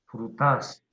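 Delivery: "Frutas" said fru-TÁS, ending stressed; wrong stress on TAS. Shown as a demonstration. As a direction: hit the last syllable of "Frutas". ("Frutas" con acento exagerado al final, frutás)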